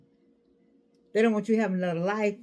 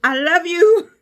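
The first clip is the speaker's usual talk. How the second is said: shouted I love you, much louder and higher; excited; 10 semitones higher, 15 dB louder, slower than usual. Real, not acted. Card player thrilled about a game, excited shout. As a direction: burst out in delight. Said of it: "I got excited".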